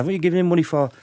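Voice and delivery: a hint of wifely protest; lightly imitated nagging wife. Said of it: "As women are".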